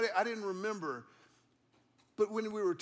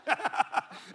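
laugh; 7 semitones higher, 3 dB louder than usual. Real, not acted. Pastor laughs at friend's question.

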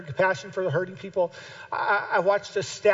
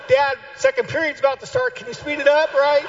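put-on higher, hurrying kid's voice; impatient kid; 7 semitones higher, 5 dB louder than usual. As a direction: nag Dad to speed up. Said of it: "And I'll keep going".

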